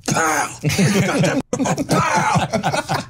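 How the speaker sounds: mock henchman yell. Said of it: "I just go".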